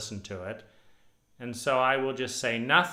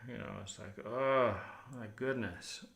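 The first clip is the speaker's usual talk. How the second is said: slow, worn-out groaning oh my gosh; exhausted sigh; 5 dB quieter than usual. Retold or imitated. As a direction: let the tiredness drag the words down. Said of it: "see the exhaustion and just go".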